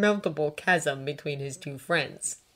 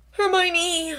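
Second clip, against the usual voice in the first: high, drawn-out groan of protest; 13 semitones higher, 5 dB louder than usual. A narrator's reading. Draco fed up with Hermione, groaning. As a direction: groan her name. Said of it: "Draco groaned".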